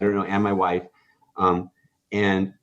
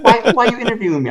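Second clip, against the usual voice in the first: much higher, louder, fast, with a laugh; laughing excitement; 13 semitones higher, 8 dB louder, faster than usual. Retold or imitated. Asking the kid reporter why he interviews him, laughing. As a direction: laugh it out in delighted disbelief.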